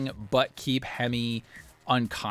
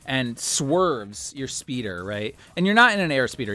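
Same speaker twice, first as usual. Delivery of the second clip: ordinary talk, nothing marked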